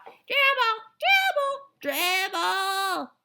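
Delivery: put-on excited children copying the yell; high loud singsong shout; kids shouting. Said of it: "they started to shout".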